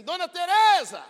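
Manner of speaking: high, loud call across the street; neighbor's shout. Retold or imitated. Neighbor calling the mother from the street, loud high shout. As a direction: yell the name over the wall. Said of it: "elas dão um grito e dizem assim".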